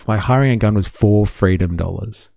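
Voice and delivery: ordinary delivery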